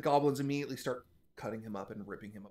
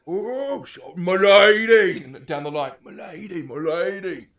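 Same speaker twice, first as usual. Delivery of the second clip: mock courtly hobgoblin; 5 semitones higher, 15 dB louder, much slower than usual. Hobgoblins bow mockingly.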